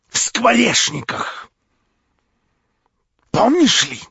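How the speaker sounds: нарастает до крика, высоко; бросай колкие воспоминания; язвительный упрёк. Acted. Варвара язвительно упрекает. (язвительные упрёки с нарастанием)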